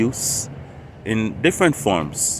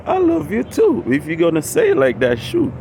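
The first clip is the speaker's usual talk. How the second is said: squeaky falsetto reply, much higher; mock high reply; 7 semitones higher than usual. Answering the YouTuber on screen, squeaky falsetto. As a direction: jump way up into falsetto. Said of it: "and I'm like".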